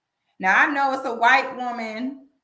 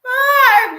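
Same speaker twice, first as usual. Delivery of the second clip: high loud cackling cry; laughing shriek; 10 dB louder than usual. Real, not acted. Host cracking up at her own joke, loud shriek.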